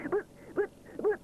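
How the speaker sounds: high, tripping repeated buts; can't get the words out; stuttering. A narrator's reading. Rupert trying to interrupt his mother, stuttering. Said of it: "stutters Rupert".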